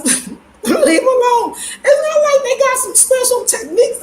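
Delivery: high, laughing and half-crying through words. Real, not acted. Host answering a viewer's question through laughter.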